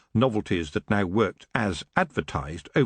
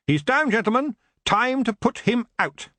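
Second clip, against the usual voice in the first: rallying call; 11 semitones higher than usual. Acted. Rallying the old boys.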